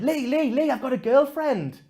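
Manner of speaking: put-on excited little boy. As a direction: play a six-year-old bursting with news. Six-year-old boy's proud news.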